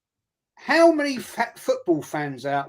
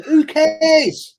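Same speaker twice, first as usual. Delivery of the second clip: high, loud dismissive yell; incredulous outburst; scoffing shout; 8 semitones higher, 8 dB louder than usual. Real, not acted. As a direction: throw it away with a loud scoff. Scoffing at rival pundit.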